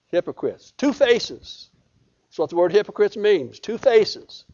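usual speaking voice

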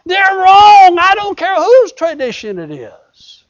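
loud, high-pitched sermon shout; full-force preaching. Acted. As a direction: thunder it out at the congregation.

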